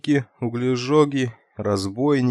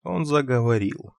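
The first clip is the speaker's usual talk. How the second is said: plain reading voice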